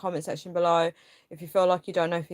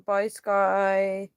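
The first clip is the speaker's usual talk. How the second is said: normal talking voice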